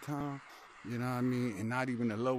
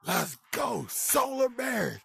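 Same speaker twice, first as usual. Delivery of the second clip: higher, louder, stretched out cheer; hyped cheer; 11 semitones higher, 4 dB louder, much slower than usual. Retold or imitated. Retelling his draft day, a higher, slow stretched cheer. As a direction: shout it like a fan at the draft. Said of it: "I was like".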